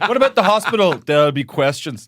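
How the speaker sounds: mock-alarmed interviewer; a touch high-pitched, fretting at the interviewee; Mark Kermode. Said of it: "Mark Kermode's interviewing him".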